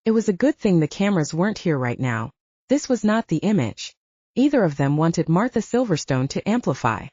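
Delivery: ordinary delivery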